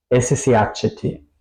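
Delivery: ordinary talk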